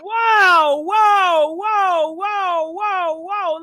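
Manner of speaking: high, loud startled whoas fading off; shocked whoa. Real, not acted. Host stops a guest's story in shock, high startled whoas. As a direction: rein the caller in, stunned.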